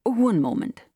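understated startled interjection; astounded interruption. A narrator's reading. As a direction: cut in with a touch of surprise. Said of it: "interrupted the astounded colonel".

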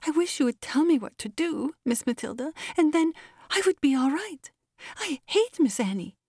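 tearful complaint. A narrator's reading. Molly cries to Miss Matilda about Annie, plaintive. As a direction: wail the complaint in a high voice.

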